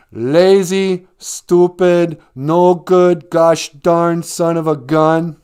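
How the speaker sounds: loud, high mock yelling, slow at the end; abusive father. Bender acting out his father screaming at him, hammed-up yell. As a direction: bellow insults like a raging dad. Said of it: "he didn't clean it up".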